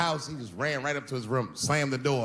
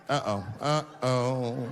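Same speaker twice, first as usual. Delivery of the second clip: mock uh-oh; much slower than usual. Mock dread.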